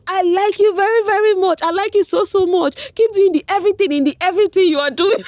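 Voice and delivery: high, loud, excited gushing mother; K Bobo's mom. Retold or imitated. K Bobo's mom praising her son, high and excited. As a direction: gush like an overjoyed mom. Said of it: "the way she was speaking".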